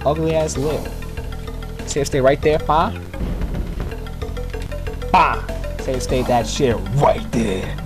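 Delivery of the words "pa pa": ordinary talk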